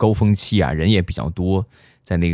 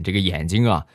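usual speaking voice

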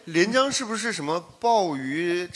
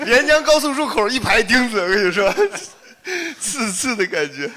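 laughing; 7 semitones higher, 4 dB louder than usual. Real, not acted. Joking amid group laughter.

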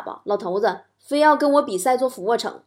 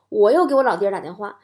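normal talking voice

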